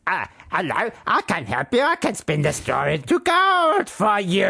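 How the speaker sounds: high-pitched storybook goblin offering help; gnome voice. Acted. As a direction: squawk it out as an eager little gnome. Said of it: "That's how he sounds".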